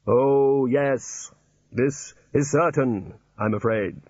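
hollow, measured voice of Death; Death's voice. Acted. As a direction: speak as Death, dry and solemn. Death speaking.

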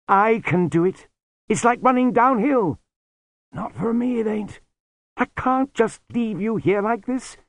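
put-on high, eager young man; young Lobsang voice. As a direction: protest earnestly as the boy.

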